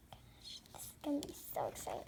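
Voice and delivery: hushed, hurried whisper; excited whisper. Pretend. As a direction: whisper eagerly before a show starts. Audience murmurs before the show.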